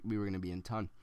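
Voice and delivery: ordinary delivery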